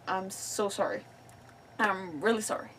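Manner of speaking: usual speaking voice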